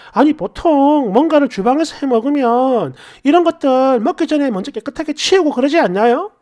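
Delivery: ordinary delivery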